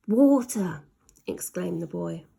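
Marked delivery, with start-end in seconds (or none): 0.1-0.8 louder excited exclaim; eureka cry. Acted. Ned discovering the cats' fear, loud. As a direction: shout the idea.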